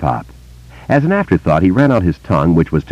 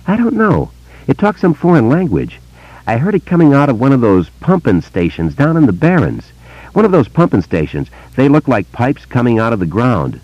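put-on higher, eager boy's voice; young boy; 8 semitones higher than usual. A narrator's reading. Boy telling Bill about voices, in a higher boy's voice. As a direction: voice a wary small boy telling a secret.

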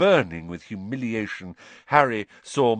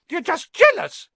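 very high, loud schoolboy retort; piping up; 8 dB louder than usual. A narrator's reading. Colin defending Harry against Malfoy, piping up. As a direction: pipe up loudly in a squeaky boy's voice. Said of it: "piped up Colin".

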